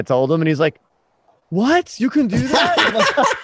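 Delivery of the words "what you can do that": very high, drawn-out incredulous question; mock hurt disbelief. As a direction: imitate a wounded, astonished rival. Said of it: "Dane was so hurt when he's like".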